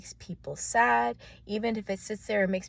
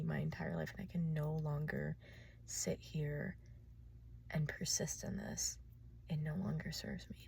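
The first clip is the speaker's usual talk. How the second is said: quiet; 3 semitones lower, 9 dB quieter than usual. Acted. Addresses old self, quietly.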